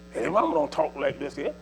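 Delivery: normal talking voice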